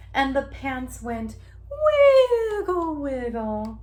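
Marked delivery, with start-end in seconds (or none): none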